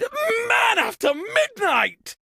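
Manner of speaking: high, shocked shout of a made-up oath; startled cry. Acted. Host startled by the blackout, high shocked shout. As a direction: yelp the oath in sudden alarm.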